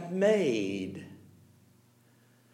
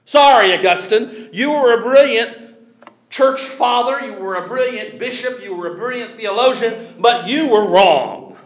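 loud, rising rebuke to Augustine; forceful preaching; 3 semitones higher, 6 dB louder than usual. Real, not acted. Preacher rebukes Augustine before the congregation, loud and forceful. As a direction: call him out from the pulpit.

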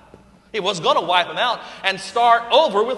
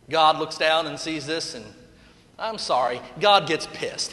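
usual speaking voice

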